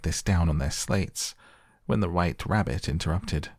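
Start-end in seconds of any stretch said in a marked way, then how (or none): none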